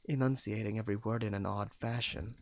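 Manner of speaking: ordinary delivery, nothing marked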